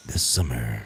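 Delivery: extremely low, soft trailer voice; Sagan. Retold or imitated. Host imagining his deep-voiced friend announcing, very low voice. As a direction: drop to the very bottom of your range. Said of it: "get Sagan on here to fucking be like".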